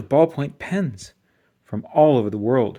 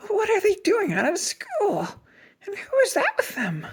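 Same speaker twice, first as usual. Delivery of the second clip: very high, scratchy, bewildered. A narrator's reading. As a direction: rasp out your outrage. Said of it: "in a scratchy voice".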